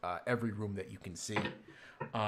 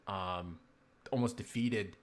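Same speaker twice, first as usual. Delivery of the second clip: ordinary delivery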